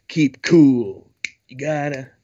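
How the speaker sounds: lazy, slow stoner drawl; lightly voiced stoner dude. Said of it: "stoner voice".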